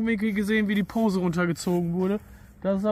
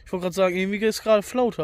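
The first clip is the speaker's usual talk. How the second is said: ordinary talk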